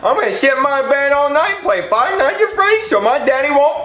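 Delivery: put-on giddy childish rush. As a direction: blurt it out giddily with a giggle. Kid gleefully announces his plan.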